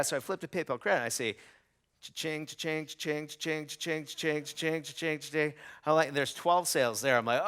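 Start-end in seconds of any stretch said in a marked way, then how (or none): 2.0-5.5 playful imitated register chimes, repeated; mock cha-ching noises; cash register cha-ching. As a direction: ring up each sale like a till. Imitating sales notifications coming in.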